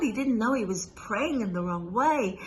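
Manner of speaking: ordinary delivery, nothing marked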